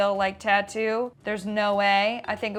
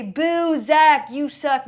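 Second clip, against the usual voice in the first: loud, raised playful boo; mock heckling; 4 semitones higher, 5 dB louder, slower than usual. Getting everyone to boo Zach as a joke, mock heckle. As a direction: lead a joking crowd jeer. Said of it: "So everyone say".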